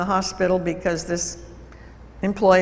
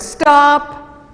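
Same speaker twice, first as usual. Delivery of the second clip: sharp, high, loud command; firm shout; 6 semitones higher, 8 dB louder than usual. Retold or imitated. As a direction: cut him off with one loud word. Calling out to a raging employee.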